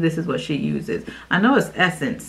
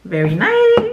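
excited squeal; 13 semitones higher, 4 dB louder than usual. Real, not acted. Admiring product.

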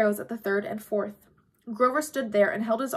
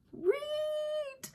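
a huge boar's squeal, far higher and drawn out; pig squeal; 7 dB quieter than usual. A narrator's reading. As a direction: squeal like a giant wild pig. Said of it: "it squealed".

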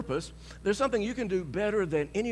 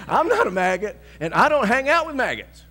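loud, higher, indignant comeback; firm loud retort; 6 semitones higher, 9 dB louder than usual. Retold or imitated. Preacher answering the insulting man, indignant loud comeback. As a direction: throw it right back at him.

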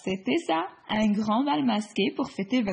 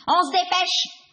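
commanding; 8 semitones higher, 5 dB louder than usual. Acted. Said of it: "ordonne le guépard".

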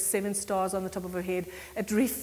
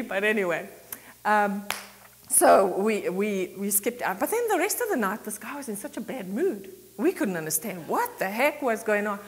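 normal talking voice

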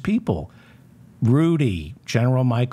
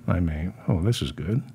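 normal talking voice

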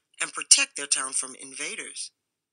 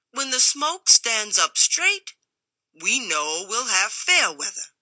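Rufus lecturing; 6 semitones higher, 6 dB louder than usual. A narrator's reading. Bathmaster lectures the stranger about the smoke, loud. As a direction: explain it like it's obvious. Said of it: "rumbled a voice".

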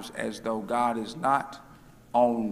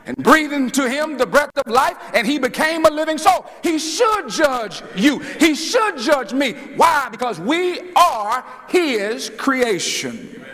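preaching; 9 semitones higher, 4 dB louder than usual. Real, not acted. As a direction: preach it at full force, stretching the big words. Preaches at full force.

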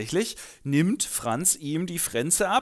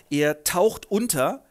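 ordinary delivery

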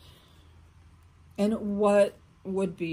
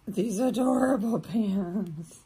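normal talking voice

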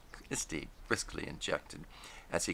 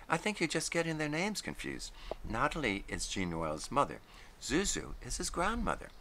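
higher voice; Gwen; 7 semitones higher than usual. A narrator's reading. Gwen gently asking Christy about his dog, higher voice. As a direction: ask gently, pitched up.

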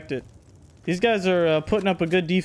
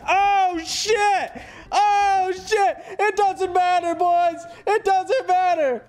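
excited yelling; 11 semitones higher, much slower than usual. Real, not acted. Raid chaos, shouting to teammates.

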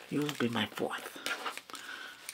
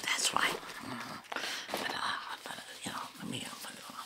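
whispering; 6 dB quieter than usual. Acted. ASMR whisper.

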